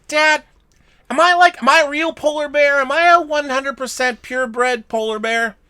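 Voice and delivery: put-on baby bear anxiously asking its father, high child voice; baby polar bear. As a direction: squeak it out like a worried little cub. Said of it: "baby polar bear goes to his dad and says".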